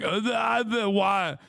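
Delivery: mock clueless stammer; dumb conservative. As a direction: play the confused caller. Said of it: "they're like".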